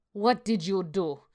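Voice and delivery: put-on a furious old woman in a Jamaican accent, slowly; Jamaican accent through clenched teeth. A narrator's reading. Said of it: "through clenched teeth in her thick Jamaican accent".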